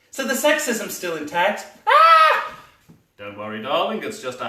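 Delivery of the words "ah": very high, loud startled scream; sudden scream. Acted. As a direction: shriek as if something leaps out. Sudden fright in scene.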